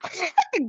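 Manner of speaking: high-pitched burst of laughter. Real, not acted. Amused at Alexa's apology, a high laugh.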